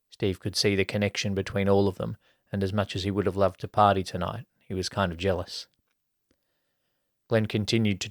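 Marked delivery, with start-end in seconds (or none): none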